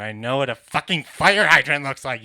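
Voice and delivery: loud exasperated outburst; indignant shout. Acted. Comic arguing with a captcha over fire hydrants, indignant shout. Outraged at being told he's wrong.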